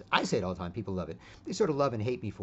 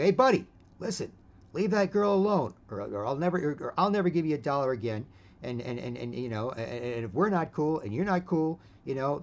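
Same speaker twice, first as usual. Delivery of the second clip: loud, higher warning yell; 5 semitones higher, 5 dB louder than usual. Retold or imitated. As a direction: snap at him to back off. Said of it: "I'm yelling at him".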